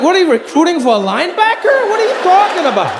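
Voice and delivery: high, fast disbelief; incredulous. Retold or imitated. Son mocking his dad's taste, high and incredulous.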